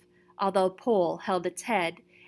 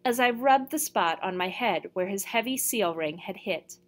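usual speaking voice

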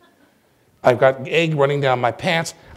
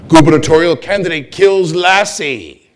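mock headline; 6 semitones higher, 11 dB louder than usual.

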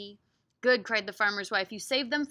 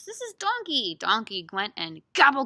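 ordinary delivery, nothing marked

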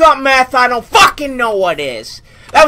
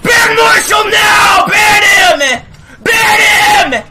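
furious shout; 7 semitones higher, 4 dB louder, slower than usual. Real, not acted. Raging at enemy player.

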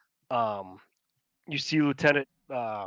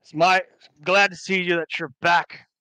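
raised, louder military address; saluting officer; 5 semitones higher, 6 dB louder than usual. Acted. Giles salutes returned governor, crisp military greeting. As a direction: report smartly to your superior.

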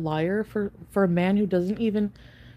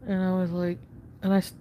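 usual speaking voice

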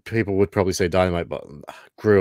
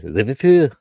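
mock Scouse accent; 5 semitones higher, 9 dB louder than usual. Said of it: "I cannot stand the accent at all".

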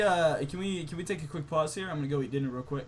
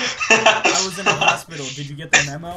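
mock friend voice; 13 dB louder than usual. Reading his friend's text aloud to the group, loud mock voice. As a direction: ham up the friend's excuse.

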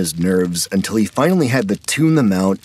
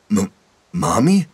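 stammering. A narrator's reading. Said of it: "Emilio stammered".

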